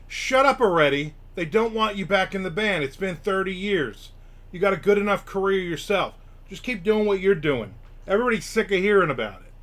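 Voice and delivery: put-on a rocker fed up with old questions. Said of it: "his thing was basically".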